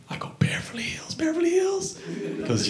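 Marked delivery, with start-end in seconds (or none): 0.4-1.1 breathless disbelieving whisper; stunned whisper. Retold or imitated. 1.1-2.0 very high disbelieving cry; shocked squeal. Retold or imitated.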